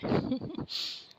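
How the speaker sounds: high breathy giggle at a friend; teasing laugh. Acted. Teasing friend on the phone, high playful giggle. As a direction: laugh at her friend's misery. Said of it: "Sneha हंसने लगती है".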